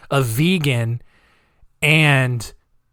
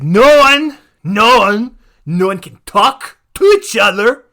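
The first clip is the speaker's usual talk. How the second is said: mock shout; 6 semitones higher, 9 dB louder, slower than usual. Mocking the no-talking rule.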